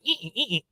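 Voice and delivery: high-pitched repeated "ee" squeaks; imitated window squeaking. Recapper mimics Leoma wiping the windows, squeaky noise. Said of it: "you just hear that".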